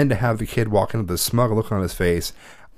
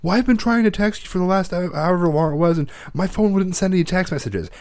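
put-on higher, pleading kid's excuse voice; whiny teen excuse; 9 semitones higher than usual. A son excusing himself to his mother, higher and whiny. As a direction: play the defensive teenage son. Voicing son's excuse, retold.

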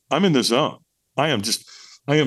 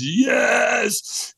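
high, stretched-out shouted yes; retold top-of-lungs scream; 10 semitones higher than usual. Firewalker answering his trainer, top-of-lungs scream. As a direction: cry it out with hands in the air. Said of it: "I screamed at the top of my lungs".

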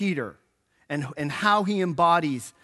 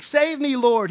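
a cry for help, only a bit raised; mild shout; 7 semitones higher, 5 dB louder, slower than usual. A narrator's reading. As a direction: call out to be saved, half-hearted. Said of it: "he shouted".

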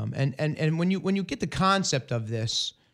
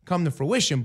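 ordinary delivery

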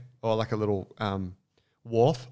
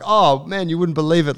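eager excited; 6 semitones higher, 6 dB louder than usual. Retold or imitated. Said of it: "And I was like".

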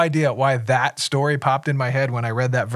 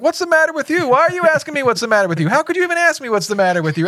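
mock outrage; 13 semitones higher, much faster than usual. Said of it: "And they're like".

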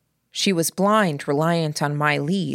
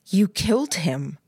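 accusatory; 4 semitones higher than usual. A narrator's reading. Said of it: "It was almost accusatory".

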